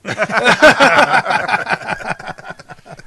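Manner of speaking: loud high cackle of laughter; big laugh. Real, not acted. Friend laughing loudly at the joke man's line. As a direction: crack up loudly.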